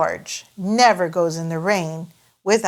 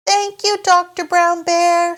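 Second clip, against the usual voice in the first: high, louder grateful mother; Mummy Pig voice; 10 semitones higher, 4 dB louder than usual. Acted. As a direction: thank him like a polite mum. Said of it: "says Mummy Pig".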